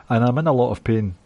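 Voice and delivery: ordinary delivery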